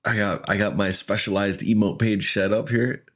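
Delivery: normal talking voice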